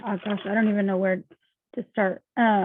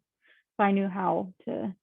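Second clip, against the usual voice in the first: usual speaking voice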